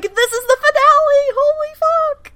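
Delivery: extremely high excited squeak; mock fangirl squeal. Fans realise this is the finale, squealing. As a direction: re-enact losing it with excitement, very high.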